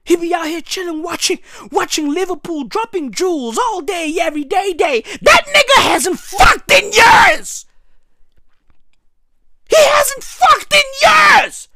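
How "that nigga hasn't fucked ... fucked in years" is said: slow, loud, higher drawn-out shout; emphatic shout. Real, not acted. Insisting about his granddad, slow emphatic shout. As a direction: hammer each word slowly.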